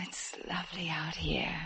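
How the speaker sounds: soft hushed whisper to the night; whispered awe under the moon; dreamy whisper. Acted. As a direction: breathe it out in wonder. Quiet moment under the moon.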